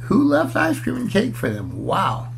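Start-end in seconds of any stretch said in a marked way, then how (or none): none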